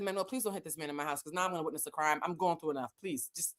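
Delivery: soft, weary plea; quick low-volume plea, worn out; hushed pleading. Retold or imitated. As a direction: beg him under your breath. Story, pleading to avoid a fight.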